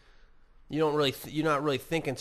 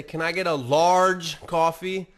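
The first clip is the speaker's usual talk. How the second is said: my usual order; 3 semitones higher, 7 dB louder, slower than usual. Retold or imitated. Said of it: "and I always say".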